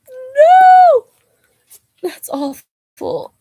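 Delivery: high, loud shriek of horror sliding into a slow groan; shocked shriek. Real, not acted. Reacting to her own dumb joke, shocked shriek. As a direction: recoil from the terrible pun.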